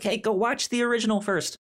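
high, chirpy imitation of a streaming service urging you; mock Netflix pitch. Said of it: "so they were like".

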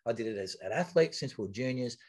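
ordinary delivery

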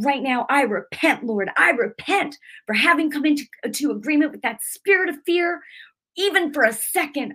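high-pitched, urgent repentance, reenacted; fervent prayer. Woman repenting to Father for fear, fervent retold prayer. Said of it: "I got mad and I went in the room".

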